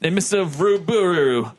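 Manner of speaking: formal officer giving testimony; a hint of stiff naval officer; lightly voiced ship's lieutenant. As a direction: read it out as a lightly acted witness statement.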